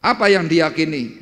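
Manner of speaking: loud, high rhetorical question; preacher calling out to the church, loud; preaching shout. Real, not acted. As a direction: hit the congregation hard with it. Preacher rising to climax.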